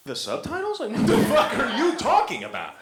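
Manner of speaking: high, incredulous mock outrage; mock baffled. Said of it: "And I'm like".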